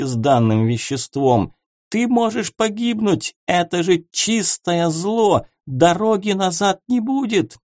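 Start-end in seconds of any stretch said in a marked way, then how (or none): 1.9-7.6 much higher worried pleading; anxious plea. A narrator's reading. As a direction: warn of danger urgently. Gerent pleads.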